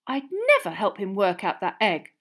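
loud outraged denial. A narrator's reading. Girl denying she would betray her school, outraged. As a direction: deny it with loud emphasis. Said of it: "looking outraged".

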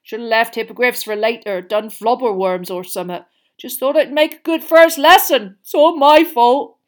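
loud lament, rising in pitch; Hagrid, miserable. A narrator's reading. Gamekeeper blames himself for first lesson, miserable. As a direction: blame yourself in a big wretched voice. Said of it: "said Hagrid miserably".

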